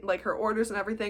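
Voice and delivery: ordinary delivery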